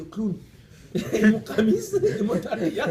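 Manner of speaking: laughing through the words. Real, not acted. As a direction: giggle at your own clown look. Laughing at his own looks.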